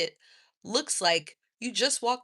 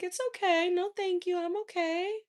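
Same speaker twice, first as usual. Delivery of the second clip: sweet polite decline; 8 semitones higher than usual. Retold or imitated.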